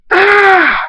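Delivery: long, high-pitched scream; sustained piercing "ah" scream; loud high scream. A narrator's reading. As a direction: scream out loud on one held note. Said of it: "Darkstar screams".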